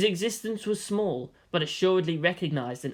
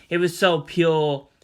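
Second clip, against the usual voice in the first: ordinary delivery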